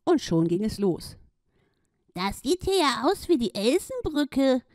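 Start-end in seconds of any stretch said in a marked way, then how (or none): 2.1-4.6 surprised. Acted. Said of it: "rief die Ente überrascht".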